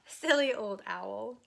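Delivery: usual speaking voice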